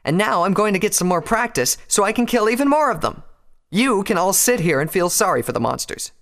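high, loud, scornful defiance; angry parting shot. A narrator's reading. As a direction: have the last word before storming off. Rainbow storms out of the quarrel.

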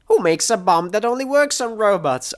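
high-pitched outraged retort; incredulous scream. A narrator's reading. Screaming back at companion over the grenade, incredulous scream. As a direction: scream the question back in disbelief. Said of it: "I screamed back at her".

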